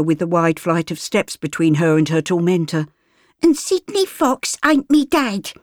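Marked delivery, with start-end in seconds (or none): 3.4-5.5 put-on high, defiant girl; a child denying her keeper; street girl. Flora denies Gert is her mother, high.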